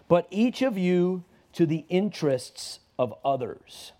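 slow, weighty scripture reading. Acted. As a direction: lay each phrase down slowly. Reads scripture slowly.

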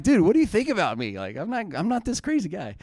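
high-pitched, incredulous dad objection; mock protest. Dad protesting son's nervous confessions.

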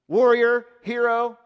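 loud, high ringing words; emphatic shout. Real, not acted. Preacher calling out Gideon's titles to the church. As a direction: ring out each title.

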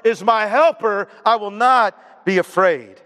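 high-pitched retort slowing into bold proclamation; defiant declaration. Acted. Believer talks back to taunting situations, defiant confident declaration. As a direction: talk back, then stretch the verse out firmly. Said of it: "you say that to your situations".

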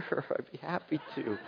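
grit-free servant answer, softly; mock cheerful reply. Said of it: "Mark, would you mind".

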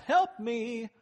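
high, loud desperate call; cry for help. Acted. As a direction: yell it like you're drowning. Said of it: "when I cry out".

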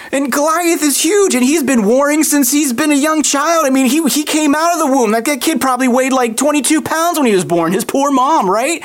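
high, rattled king protesting; incredulous king. Acted. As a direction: argue in disbelief, pitched well up. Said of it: "the king's like".

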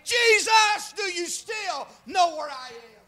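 high, loud cry out to Jesus; impassioned preaching. Acted. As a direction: shout the confession like a desperate plea. Preacher cries out Samson's plea.

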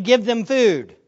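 loud, hard stress on "Give them food"; emphatic. Shown as a demonstration. Preacher showing the emphatic wording, "Give them food" hit hard. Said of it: "That's an emphatic way of saying".

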